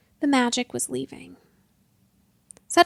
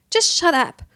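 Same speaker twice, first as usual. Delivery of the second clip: louder angry repeat; 5 semitones higher, 6 dB louder than usual. Acted. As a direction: say it again harder and louder, fed up. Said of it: "She stamped her foot".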